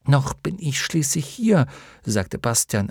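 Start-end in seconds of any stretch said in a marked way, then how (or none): 0.1-1.7 higher, mildly offended; a little hurt. A narrator's reading. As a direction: protest with wounded pride. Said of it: "ein wenig gekränkt". (ein wenig gekränkt)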